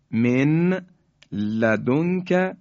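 distinct n and l in من لدنک; nun left unmerged. Shown as a demonstration. Tajweed teacher repeating the written form, audible n in من لدنک. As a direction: separate min from ladunka in من لدنک. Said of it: "یعنی نون ساکن".

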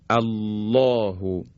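الله with thick, full lam, slow; heavy lam. Shown as a demonstration. Teacher demonstrating heavy lam to students. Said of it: "مانند".

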